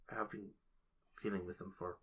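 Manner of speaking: put-on soft, fading old man murmur; hushed trailing voice. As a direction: let it fade to a breathy hush.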